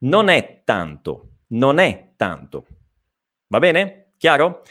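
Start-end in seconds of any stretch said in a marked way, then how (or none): 0.0-2.7 "non è tanto" slowly, n joined to è; slow model phrase. Shown as a demonstration. Teacher repeating "non è tanto" for practice.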